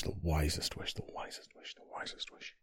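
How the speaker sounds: a repeated whisper dying away; fading whisper. Acted. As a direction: whisper that fades away to almost nothing.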